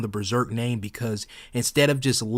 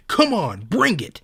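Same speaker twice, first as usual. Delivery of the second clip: a hint of a corny hero's challenge, a bit louder; mock tough-guy quote; 6 semitones higher, 5 dB louder, slower than usual. Ranting fan quoting Zod's corny line, mild mock bravado. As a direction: lightly sneer the line as cheap shonen bravado. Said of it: "Zod in a panel saying".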